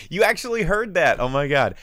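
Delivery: loud, high burst of disbelief; real surprise. Real, not acted. Host reacting to a viewer's comment, loudly surprised. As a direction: exclaim it, startled and delighted to be caught. Learns a listener overheard his shout.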